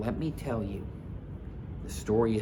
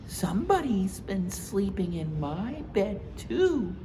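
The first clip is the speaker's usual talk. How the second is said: Mama Bear voice; 10 semitones higher than usual. Acted. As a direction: agree brightly with Papa.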